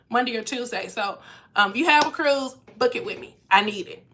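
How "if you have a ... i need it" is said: ordinary talk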